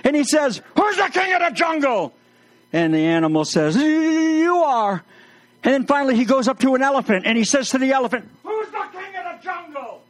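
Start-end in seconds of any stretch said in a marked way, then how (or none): 0.8-2.1 high strained lion voice, far from his own; cartoon lion demanding an answer; bullying lion. 3.8-5.0 high, stuttering little voice; mock terrified stammer; stammering scared animal. Frightened animal stammers reply. 8.4-10.0 high strained lion voice, far from his own; cartoon lion demanding an answer; bullying lion.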